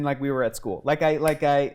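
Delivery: usual speaking voice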